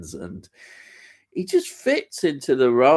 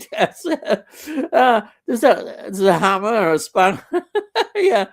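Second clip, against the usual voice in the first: laughing through the words, higher; 8 semitones higher, 5 dB louder than usual. Real, not acted. Host amused by a viewer's hammer emoji, laughing. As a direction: giggle while reading the emojis out.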